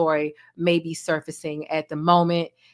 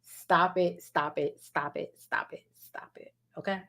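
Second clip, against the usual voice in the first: fading self-calming; 8 dB quieter than usual. Real, not acted. Creator holding back tears, fading to a whisper. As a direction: stop it, fading softer down to a whisper. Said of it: "Don't get me emotional".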